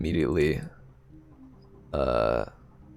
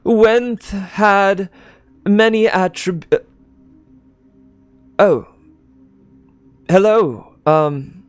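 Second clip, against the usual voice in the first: high, loud speech voice breaking off; eulogist interrupted; 12 semitones higher, 5 dB louder, much slower than usual. Acted. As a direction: trail off mid-eulogy, surprised. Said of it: "the guy up here quiets down a bit and goes".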